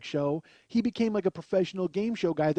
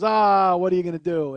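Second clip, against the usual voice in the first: verbal shrug; 2 semitones higher, 10 dB louder than usual. Retold or imitated. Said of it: "the captain just kinda shrugs".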